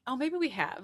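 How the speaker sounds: ordinary talk, nothing marked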